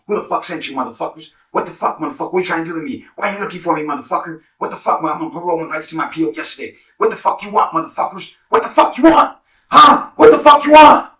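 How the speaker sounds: starts tense, builds to a high screaming demand; retold interrogation rising to a shout; building to a yell. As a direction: escalate from barking questions to a full scream. Retold yelling at men.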